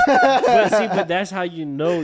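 talking through laughter; laughing. Real, not acted. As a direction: laugh while saying it. Laughing while making his point.

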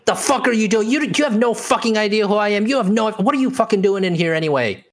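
high-pitched, furious brush-off; angry outburst. Acted. Same hostile NPC ranting on.

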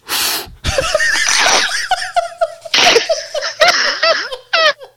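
howling laugh. Real, not acted. Big laugh at the fart story.

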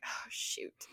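whispered under the breath, no voice; hushed aside. Retold or imitated. Realizing her dad is still on the road, a whispered oh shoot. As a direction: breathe it out like a private letdown.

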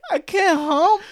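high, loud mock crying; hammed-up tearful plea. Lalin begging betrayed friend Carlito, mock-tearful apology. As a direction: wail it like a pathetic whimperer. Acting Lalin pleading in wheelchair.

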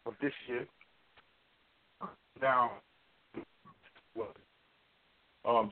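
call dropping out. Real, not acted. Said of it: "you're breaking up".

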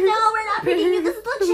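mocking imitation. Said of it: "You sound like such".

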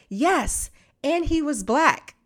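put-on high little-girl voice, slow; small child's innocent reply; imitating the toddler. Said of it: "the little girl was like".

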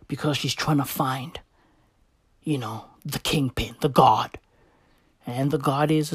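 low, soft conspiratorial whisper; hushed. Real, not acted. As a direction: share a secret theory quietly.

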